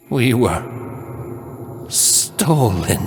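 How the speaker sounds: very slow drawn-out eerie lament; haunting shard voice. A narrator's reading. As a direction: stretch every word, ghostly. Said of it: "louder this time".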